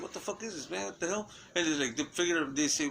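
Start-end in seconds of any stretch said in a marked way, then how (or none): 0.0-1.3 imitating baffled aliens.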